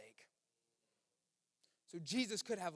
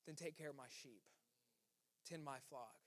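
soft, tender command, much softer; gentle quiet Jesus; 4 semitones lower, 11 dB quieter than usual. Acted. Jesus softly entrusting Peter with his flock, tender and quiet. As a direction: tell him gently to care for the sheep.

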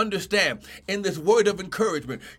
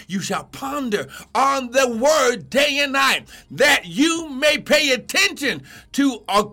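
raised, insistent sermon cadence; full-force preaching; 4 semitones higher than usual. Real, not acted. Preacher teaching how to meditate on scripture, fervent preaching. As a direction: press every phrase on the listeners.

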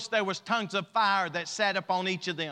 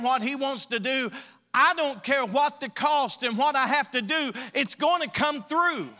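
rising, louder preaching fervor; building preaching; 6 semitones higher, 6 dB louder than usual. Real, not acted. Pastor building up to the congregation. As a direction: preach it harder and harder.

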